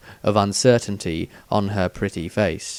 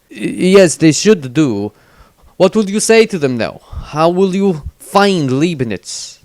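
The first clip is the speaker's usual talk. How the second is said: higher, louder stammering priest; a dazed priest stuttering out of a trance; shaken priest; 6 semitones higher, 5 dB louder than usual. A narrator's reading.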